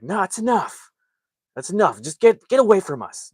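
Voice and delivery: mock-angry, high-pitched telling-off; fed-up dad. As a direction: imitate an exasperated father warding someone off. Acted dad fed up with Rusty.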